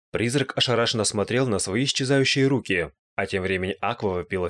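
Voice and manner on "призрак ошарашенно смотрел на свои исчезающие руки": ordinary delivery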